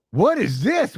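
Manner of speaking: high, exaggerated incredulous question; mock disbelief. Soldiers reacting to the private's stress card, mock disbelief. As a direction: act out a stunned group reaction.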